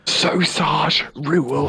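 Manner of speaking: slow, high, loud hammed-up Brit; exaggerated English accent, slow; mock English accent. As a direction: stretch it out like a cartoon Englishman. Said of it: "Let me try".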